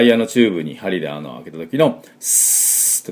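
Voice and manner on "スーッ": long sharp S like a punctured tire; sharp air-leak hiss. Shown as a demonstration. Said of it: "空気が鋭く漏れる音を作ってください". (タイヤから空気が鋭く漏れる音)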